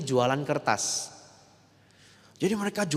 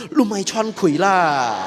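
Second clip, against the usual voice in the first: scolding; 5 semitones higher, 8 dB louder, slower than usual. Retold or imitated. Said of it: "kalau suaminya gerak dia pukul".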